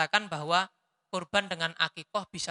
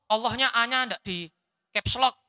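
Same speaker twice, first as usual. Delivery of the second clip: ordinary delivery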